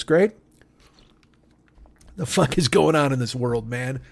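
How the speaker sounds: ordinary delivery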